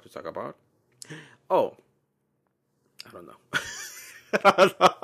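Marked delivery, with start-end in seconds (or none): 3.5-5.0 high, loud, helpless giggle; laughing. Real, not acted. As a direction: blurt it out through loud laughter. Cracks up at losing the thread.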